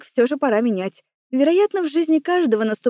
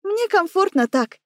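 higher, playful reply; playful wink; 7 semitones higher than usual. A narrator's reading. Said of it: "подмигнула мне". (игриво, с подмигиванием)

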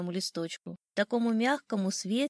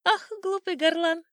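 high, loud, gleeful bird jeer; mocking old sparrow; 9 semitones higher, 6 dB louder than usual. A narrator's reading. Sparrow jeering at the crowing rooster, high mocking chirp. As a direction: scoff up high like a tiny bird. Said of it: "смеялся старый воробей".

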